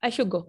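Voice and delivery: "I should go" said as "I shug go" with no d; quick "shug go" demo; assimilated. Shown as a demonstration. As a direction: merge should and go. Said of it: "इस तरह का word आएगा".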